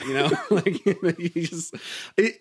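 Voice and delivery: usual speaking voice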